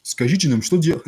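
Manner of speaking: high, loud, pushy student voice; mock the students whining at the teacher; hammed-up grumbling students; demanding Russian students. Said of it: "No, nobody speaks like that".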